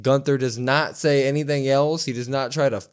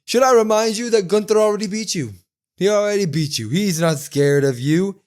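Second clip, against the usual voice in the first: higher, louder arrogant retort; lightly voiced Kaiser; 6 semitones higher, 3 dB louder than usual. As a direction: lord it over him a little. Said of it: "He goes".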